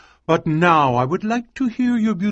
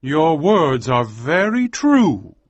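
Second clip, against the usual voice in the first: slow, a touch of sadness; husband sadly agreeing with his wife; 5 semitones higher, 4 dB louder, slower than usual. Acted. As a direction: admit it slowly and sadly. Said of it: "said Almer sadly".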